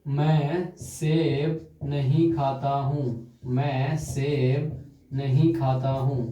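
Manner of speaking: slow dictation. Shown as a demonstration. Teacher dictating example sentence.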